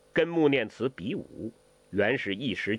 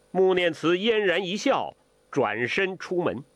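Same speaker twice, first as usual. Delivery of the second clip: usual speaking voice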